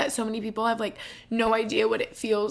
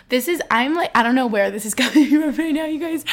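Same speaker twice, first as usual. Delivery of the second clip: louder words broken up by real laughter; embarrassed laughing; 3 semitones higher, 8 dB louder than usual. Real, not acted. Podcaster apologizing to listeners for tangent, embarrassed laughing. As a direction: laugh helplessly at your own rambling.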